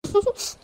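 a small bashful laugh, quiet; soft shy giggle. Acted. Wife giggling softly when husband asks her to smile. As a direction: giggle softly at his coaxing.

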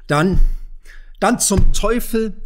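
loud, high, drawn-out curse; exclaimed outburst. A narrator's reading. Farmer gives in to his own wager, loud outburst. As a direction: burst out loudly after holding back.

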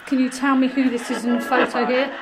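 usual speaking voice

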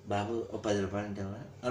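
beggar pleading. Retold or imitated. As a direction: mimic a poor beggar asking for ten rupees. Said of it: "అని అడుక్కుంటాం".

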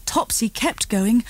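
plain reading voice

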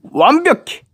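high-pitched triumphant exclamation; delighted self-approval. Real, not acted. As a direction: pop it out high, job done. 서명을 마치고 감탄. (높은 소리로 뿌듯하게 감탄)

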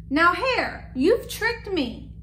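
cross bear in a read-aloud; indignant. A narrator's reading. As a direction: scold the trickster, mildly acted. Bear accuses Hare of tricking him.

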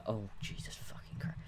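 hushed swearing under the breath; exasperated, barely voiced; muttered curse. Real, not acted. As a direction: curse almost in a whisper.

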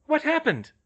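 high, loud worried question; Hermione's voice. A narrator's reading. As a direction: call out high in a woman's voice. Hermione voiced, calling from afar.

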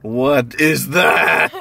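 loud, drawn-out shout of disbelief; shocked outcry. Real, not acted. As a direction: stretch each word in loud alarm. Shocked by chicken mess in car.

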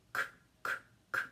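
"K, k, k" as bare voiceless clicks, no vowel; letter sound alone. Shown as a demonstration. Teacher recalling the sound of Q, "K, k, k" as isolated sounds. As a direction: pop out "K, k, k" as separate consonant sounds. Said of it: "el sonido de la Q".

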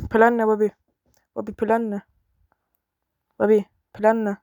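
usual speaking voice

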